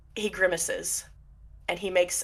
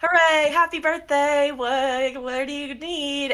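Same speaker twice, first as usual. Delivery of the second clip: forced cheer; 7 semitones higher, slower than usual. Acted. Atticus faking cheer for the kid's party. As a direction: cover the weirdness with hollow cheer. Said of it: "she's like".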